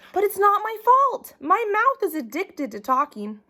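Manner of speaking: put-on high, pleading child protesting; whiny kid voice. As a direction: whine it like a caught kid. Child whining excuses to teacher.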